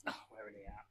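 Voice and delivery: soft unvoiced whisper. Real, not acted. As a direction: whisper the question. Whispering during recording.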